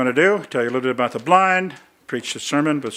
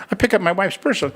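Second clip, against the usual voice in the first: normal talking voice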